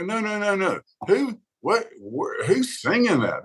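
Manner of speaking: high-pitched mock protest, swooping up; incredulous objection. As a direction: imitate a listener waving away the song, baffled. Acts out listener rejecting a demo.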